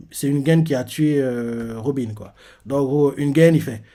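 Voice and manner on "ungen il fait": normal talking voice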